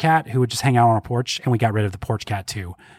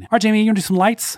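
upbeat segment intro; 9 semitones higher, faster than usual. Real, not acted.

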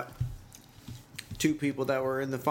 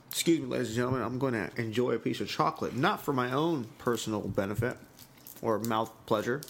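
normal talking voice

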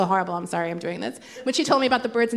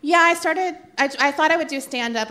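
ordinary delivery

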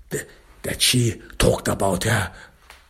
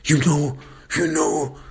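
pleading excuse; 6 semitones higher than usual. Acted. Abuser pleading his excuse in retelling.

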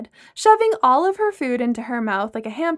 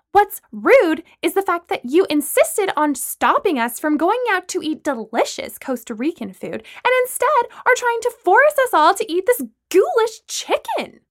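high, loud indignant rebuke; heated dinner-table tirade; indignant outburst; 9 semitones higher, 5 dB louder than usual. A narrator's reading. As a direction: throw the accusation back, rising in pitch and volume. Acted quarrel over dinner.

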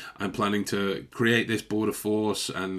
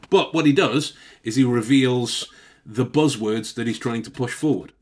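ordinary talk, nothing marked

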